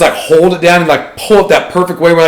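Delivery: ordinary talk, nothing marked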